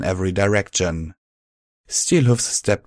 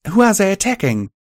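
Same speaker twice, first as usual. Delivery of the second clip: much higher, urgent asking; urgent question; 12 semitones higher, 5 dB louder than usual. A narrator's reading. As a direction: ask it sharply, pitched up. Narrator voiced high.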